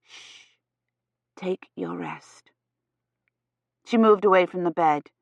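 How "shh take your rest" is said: hushed, slow, unvoiced whisper of comfort; soothing whisper. A narrator's reading. Eloise settling the upset patient to rest, whispering soothingly. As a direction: shush gently and whisper it slowly.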